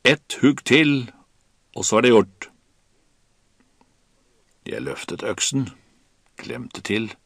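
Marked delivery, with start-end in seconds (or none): 0.0-2.5 Kaptein Wilkinson shouting. A narrator's reading. Captain urging one more axe blow, shouted. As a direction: call it out over the storm. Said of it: "skrek kaptein Wilkinson".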